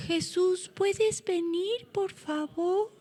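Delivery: soft, slow, high little voice; mock polite request. Preacher parodying how Bartimeo did not call Jesus, mock-meek request. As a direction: ask timidly, drawn out and hushed, as a joke. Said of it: "¿Cómo creen que Bartimeo llamó a Jesús?".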